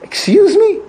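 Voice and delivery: sharp, high, indignant excuse me; dad glaring at whining kids, high indignant challenge; stern excuse me. Retold or imitated. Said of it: "You're looking at them like".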